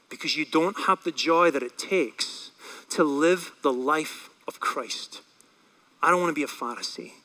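low, soft, grave challenge; hushed, sober appeal; solemn preaching. Real, not acted. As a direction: bring it down quietly with weighty pauses.

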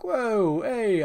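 a light mock Italian whoa hey; silly Italian. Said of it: "silly Italian kind of like".

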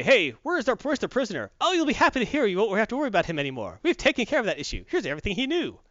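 high, cheerful robotic servant; chirpy droid voice. Retold or imitated. Droids cheerfully report to their boss, high and chirpy. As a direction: sound pleased with yourself, like a helpful droid.

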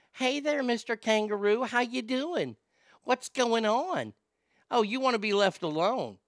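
high sweet-talking voice. Acted. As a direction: coax the kangaroo sweetly. Voicing woman to kangaroo.

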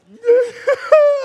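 high, loud fake bawl; mock weeping wail. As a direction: wail like a little girl, hammed up. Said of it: "I just started weeping like a little girl".

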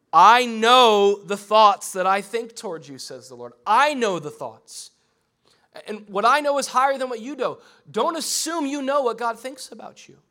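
booming sermon voice; preaching at full force. Acted. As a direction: preach it out to the back row.

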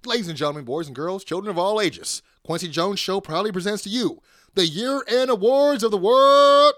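grand build to a booming finish; ring announcer. Acted. Announcer show opening, big finish.

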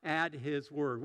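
slow emphatic sermon line; slow, deliberate declaration. Shown as a demonstration. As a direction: spell out the key sentence slowly. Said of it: "this is the sermon in a sentence".

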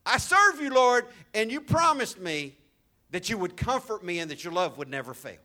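loud, high pleading prayer; David pleading. Retold or imitated. Pastor voicing David praying to God, loud and pleading. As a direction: voice David's urgent plea to God. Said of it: "He's remembering".

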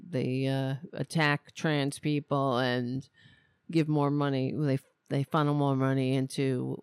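normal talking voice

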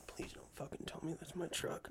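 hushed, voiceless plea; whispered dread. Retold or imitated. To himself, fearing the crash was his truck, whispered. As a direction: breathe it out like a silent prayer.

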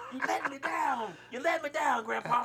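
loud, high mock-wounded scolding; hammed-up let-down kid; mock disappointment. Kid scolding crying grandpa, loud mock betrayal. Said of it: "I'm like".

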